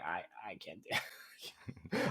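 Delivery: whispered, giving up; a tiny hopeless whisper; defeated whisper. Retold or imitated. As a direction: breathe it out like you've quit. Giving up on the game boss.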